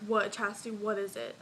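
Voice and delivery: ordinary delivery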